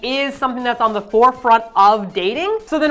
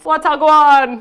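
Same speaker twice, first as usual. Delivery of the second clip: loud mock Jamaican greeting; Jamaican accent; 5 semitones higher, 6 dB louder, much slower than usual. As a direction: shout it in a hammed-up Jamaican accent. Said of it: "You do your Jamaican accent then".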